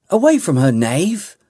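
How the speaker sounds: angry high shout of warning; a sharp battle cry. A narrator's reading. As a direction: yell it at the stranger. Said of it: "shouted Talana".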